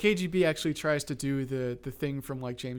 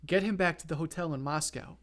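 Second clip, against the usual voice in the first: normal talking voice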